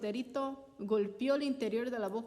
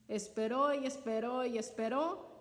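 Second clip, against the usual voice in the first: plain reading voice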